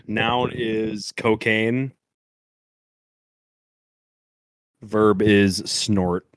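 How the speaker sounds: slow, drawn-out announcing; slow deliberate reading. Real, not acted. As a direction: spell it out very slowly. Reads the answers out slowly.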